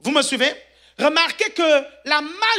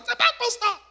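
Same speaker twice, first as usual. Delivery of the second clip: high exclamation; 11 semitones higher than usual. Acted. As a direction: squeal it out up high. Repeats the exclamation.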